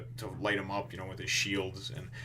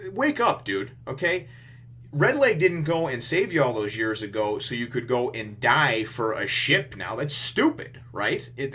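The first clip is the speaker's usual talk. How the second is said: higher, louder lecturing shout; heated scolding; 4 semitones higher, 6 dB louder than usual. Retold or imitated. Luffy scolding Sanji not to throw his life away, heated. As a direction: shake some sense into him.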